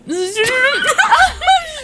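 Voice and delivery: high shrieking laugh. Real, not acted. Burst of laughter.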